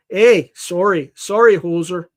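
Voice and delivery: slow, loud mock Canadian hoser voice; Strange Brew Canadian. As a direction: drawl it like a Canadian hoser from the movie. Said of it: "that's from Strange Brew".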